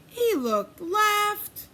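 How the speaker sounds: ordinary delivery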